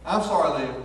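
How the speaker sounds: ordinary talk, nothing marked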